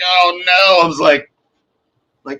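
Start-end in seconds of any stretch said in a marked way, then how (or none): 0.0-0.8 loud, high oh-no; hammed-up dismay; mock despair. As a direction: lament it loudly. Hammed-up despair as partner leaves.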